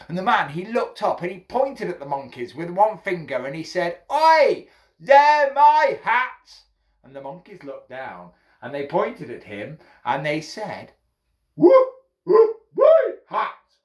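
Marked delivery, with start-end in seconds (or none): 4.1-6.6 high, loud, drawn-out protest; indignant shout. A narrator's reading. Hatter pointing up at the monkeys, indignant shout. 11.5-13.8 very high, loud monkey squawk; mimicking monkeys. A narrator's reading. Monkeys mocking the hatter from the trees, hooting mimicry. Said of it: "they're copying me".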